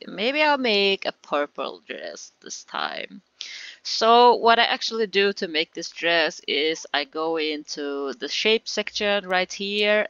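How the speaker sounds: ordinary delivery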